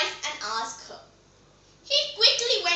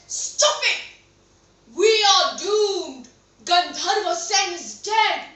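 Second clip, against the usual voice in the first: loud, drawn-out outburst; furious king; 6 dB louder, slower than usual. Acted. King silences the surprised queen with the news, furious. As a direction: explode at her, then lament loudly. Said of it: "The king was furious".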